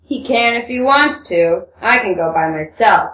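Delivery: low man's character voice; Rakitin, casual joke. Acted. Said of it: "Rakitin joked casually".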